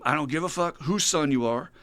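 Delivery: boss screaming. Acted. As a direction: chew him out, rising to a yell. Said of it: "And he's screaming at the guy".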